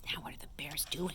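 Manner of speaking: very soft whisper; hushed, quick question; whispered aside. Real, not acted. Soft aside during the reading.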